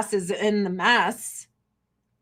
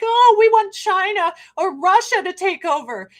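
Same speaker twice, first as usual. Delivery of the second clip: higher, louder mimicry of everyone else; mock their whine, pitched up and loud; mocking others; 9 semitones higher, 5 dB louder than usual. Streamer mimicking everyone who wants China to take over, mocking.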